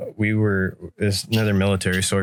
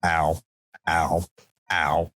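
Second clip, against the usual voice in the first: mock pained ow; 5 semitones lower, much slower than usual. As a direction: wince under cold water. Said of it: "Like, just like".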